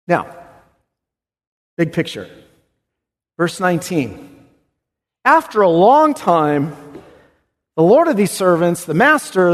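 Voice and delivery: ordinary talk, nothing marked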